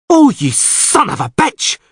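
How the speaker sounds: sudden high, slow yell of rage; an enraged sea-raider's sudden outburst. Acted. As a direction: burst out with an insult. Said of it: "Krach an Kreit yelled unexpectedly".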